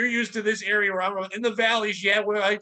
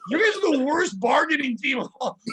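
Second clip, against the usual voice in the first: laughing disbelief; 6 semitones higher than usual. Retold or imitated. Mocking bribe offer.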